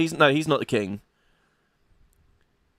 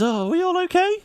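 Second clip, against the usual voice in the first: high mage woman voice; 7 semitones higher than usual. Acted. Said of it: "She says".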